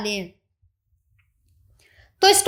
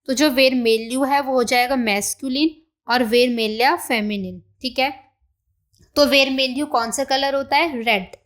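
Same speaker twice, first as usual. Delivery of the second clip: ordinary delivery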